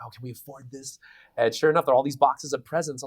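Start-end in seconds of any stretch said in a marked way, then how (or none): 0.0-1.0 worried whisper. Retold or imitated. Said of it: "I was like".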